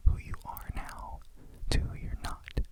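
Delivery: usual speaking voice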